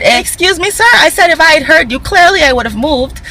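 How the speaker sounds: sassy retort. Retold or imitated. Retorting to the rude man.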